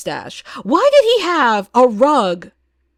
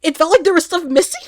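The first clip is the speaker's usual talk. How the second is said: higher, louder, mocking disbelief rising at the end; incredulous echo; 7 semitones higher, 4 dB louder than usual. Vlogger mocking reviewers, incredulous rising echo. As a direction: throw their words back, amazed they noticed. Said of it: "And I'm like".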